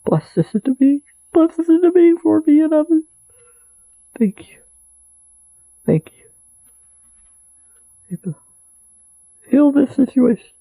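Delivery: high, choked pleading prayer; tearful prayer. Shown as a demonstration. Praying to the metaphysical, a tearful high plea. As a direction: pray as if through tears. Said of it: "now that I'm crying".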